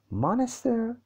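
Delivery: MONSTER as a high rising question. A narrator's reading. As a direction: ask "monster" with the pitch lifted sharply. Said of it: "he asked". (با لحن پرسشی و صدای زیر)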